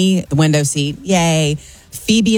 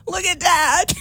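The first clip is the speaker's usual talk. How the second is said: put-on high, laughing girl's voice; squeaky kid pointing at dad; giggly daughter; 13 semitones higher, slower than usual. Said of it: "And Phoebe goes".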